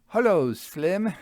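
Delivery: put-on high bright call of a young lad; a boy teasing the driver; cheeky youth. A narrator's reading. Young Soames greets driver.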